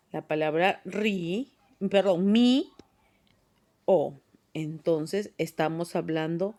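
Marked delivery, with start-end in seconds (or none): 2.3-4.1 "mi" drawn out and rising, pause, then "o"; split into syllables. Shown as a demonstration. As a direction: say "mi, o" as two separate syllables. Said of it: "Cómo sería la división". (sílabas separadas: "mi"... "o")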